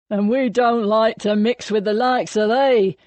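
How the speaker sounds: called from the corner. A narrator's reading. Pub regular heckling from the corner, calling out. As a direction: call it out across the taproom. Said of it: "someone called from the corner".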